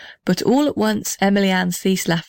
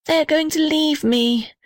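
much higher, sighing; sighing lament; 9 semitones higher than usual. A narrator's reading. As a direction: sigh out the loss. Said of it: "sighed the little red house".

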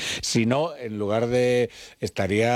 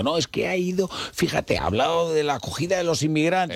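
higher-pitched mock partisan protest; voice of the left; 7 semitones higher than usual. Left-wing side citing the Pope, lightly mimicked protest. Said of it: "a la izquierda diciendo".

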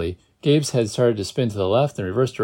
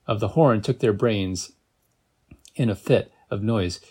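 ordinary delivery, nothing marked

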